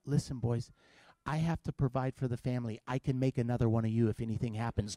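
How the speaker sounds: low, hushed, grave fatherly talk; mock solemn. Dad sending sons toward the noise, hushed mock gravity. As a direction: confide it softly as if deadly serious.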